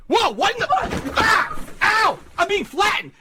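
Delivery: high panicked yelling while crushed; panicked yelps. Real, not acted. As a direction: yelp in pain as you're flattened. Being crushed under a fall.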